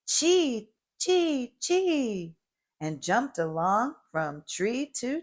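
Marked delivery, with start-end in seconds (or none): 0.1-2.4 high chattering chee chee chee; squirrel chatter. A narrator's reading. Gray squirrel chattering while jumping from tree to tree. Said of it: "A gray squirrel chattered".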